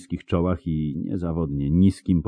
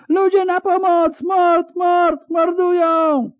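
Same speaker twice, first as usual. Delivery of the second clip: very high, loud cry of murder; piercingly high, panicked street alarm; screamed alarm; 5 dB louder, slower than usual. A narrator's reading. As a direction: scream for help, stretching the last word. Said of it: "wrzasnął ktoś z pomiędzy budynków".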